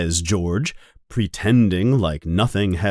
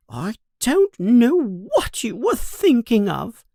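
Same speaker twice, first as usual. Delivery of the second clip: grumbling to herself; 12 semitones higher than usual. Acted. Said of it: "says things to herself".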